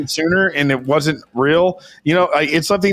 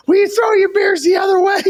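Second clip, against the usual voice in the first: put-on high, loud shrieking old lady; angry old lady; 4 dB louder than usual. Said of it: "this lady behind us is going".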